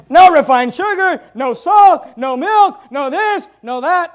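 high, emphatic chant of bans, starting loud; mocking prohibition list. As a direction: rattle off each ban as a separate punch. Parodying Gandhi's list of bans.

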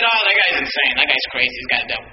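low, sinking mock of scoffing onlookers; dismissive onlookers. Acted dismissal of the preacher.

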